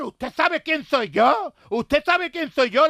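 high, loud, slow demanding general; indignant general. Retold or imitated. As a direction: draw it out, outraged and high. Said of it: "le dijo el general".

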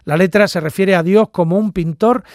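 ordinary talk, nothing marked

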